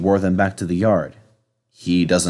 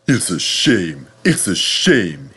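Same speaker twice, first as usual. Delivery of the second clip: hissing; 2 semitones lower, slower than usual. Acted. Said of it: "hissed Henry".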